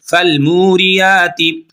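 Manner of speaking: फलमूरियाती chanted very slowly; tajweed recitation. Shown as a demonstration. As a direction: stretch मू in फलमूरियाती. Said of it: "बगैर वक्फ के पढ़ेंगे".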